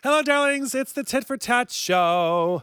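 purring show host greeting; breathy vintage purr. Acted.

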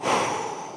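tired unvoiced sigh; exasperated exhale; weary sigh. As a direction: sigh while rubbing temples. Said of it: "Jesus, like, massaging his own temples".

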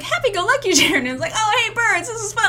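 high, bubbly, fast; chirpy cheerful nature guide; happy-go-lucky. Retold or imitated. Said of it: "everybody's used to me being like".

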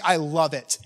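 a hint of an eager church planner; lightly voiced delighted strategist; enthused planner. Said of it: "planners are just like".

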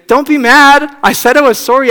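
high, loud, begging husband; pleading Joseph. Retold or imitated. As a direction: beg your upset wife to stop being mad. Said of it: "Joseph saying".

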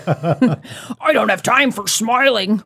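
slow mock villain line through laughter; laughing villain imitation. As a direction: copy the villain voice while laughing. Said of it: "cartoon villain from anime".

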